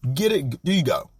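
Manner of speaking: normal talking voice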